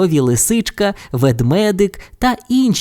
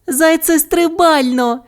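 very high, stretched-out gleeful cheer; high-pitched little character delight; joyful Bu. A narrator's reading. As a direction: rejoice slowly, pitched way up. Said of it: "раділа Бу".

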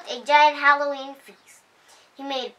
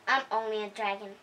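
gentle dragon; 3 semitones lower, 8 dB quieter, faster than usual. A narrator's reading. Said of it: "said Dragon".

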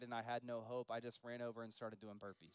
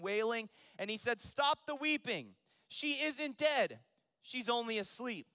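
loud Scripture telling rising to a high command; full-force preaching; 5 semitones higher, 7 dB louder than usual. Acted. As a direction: preach it out, climbing in pitch and force. Preaches the Bible story.

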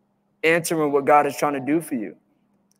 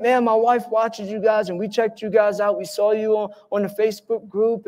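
higher voice of a gushing admirer; enthusiastic fan; 5 semitones higher than usual. Retold or imitated. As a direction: imitate a friend's eager praise, pitched up. Retold friend gushing about them.

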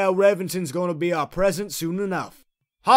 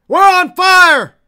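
high, drawn-out full-force yell; alarmed shout; 11 semitones higher, 11 dB louder, slower than usual. Acted. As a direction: yell that disaster has struck. Vehicle burning, crew alarmed.

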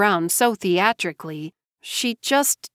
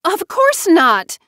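much higher and louder, slow indignant retort; appalled; 10 semitones higher, 7 dB louder, slower than usual. A narrator's reading. JoBeth rebutting Tamara, appalled and indignant. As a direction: fire it back, offended. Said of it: "JoBeth countered back, appalled".

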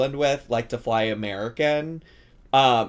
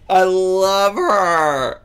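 high, loud, long-stretched adoration; loud gushing; 7 semitones higher, 8 dB louder, much slower than usual. Real, not acted. Host adoring the silly cook in the video, loud gushing. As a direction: gush it out slowly at full voice.

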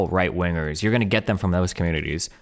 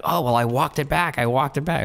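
mocking whiny voice; 7 semitones higher than usual. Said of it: "to hear somebody like".

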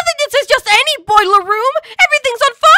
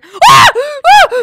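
falling scream; 4 semitones higher, 5 dB louder than usual. Acted. Falling off the climb.

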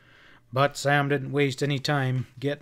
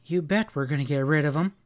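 ordinary delivery, nothing marked

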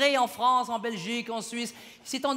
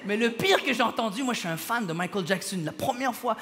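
ordinary talk, nothing marked

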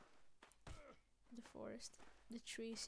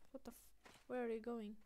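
ordinary talk, nothing marked

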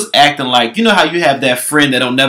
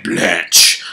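lower, mock-deep British drawl; 6 semitones lower than usual. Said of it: "Her voice was all deep and shit".

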